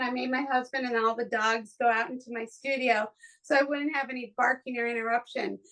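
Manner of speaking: normal talking voice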